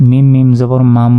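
"মাম" ends in a long hummed m; with ghunna. Shown as a demonstration. Teacher spelling out for learners, with ghunna. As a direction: hold the closing m of "মাম" through the nose. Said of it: "গুন্নার সাথে".

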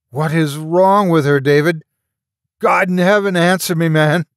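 loud, high furious demand; angry demand. A narrator's reading. Mark confronts David in the doorway, furious and loud. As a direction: force the answer out of him.

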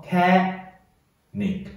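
canic split, CA lifted high and stressed, then nic apart; stressed rising syllable. Shown as a demonstration. Teacher shows where stress falls, canic with a high stressed CA. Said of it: "重音要升调上升".

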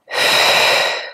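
long, audible breath out through pursed lips; mouth exhale. Shown as a demonstration. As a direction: blow the air out steadily. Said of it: "exhale out through the mouth with pursed lips".

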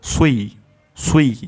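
heavy saad gliding into ee in সুই; rounded then spread. Shown as a demonstration. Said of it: "ঠোঁট গোল হবে". (ঠোঁট গোল করে, পরে ছড়িয়ে সুই)

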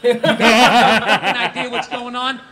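usual speaking voice

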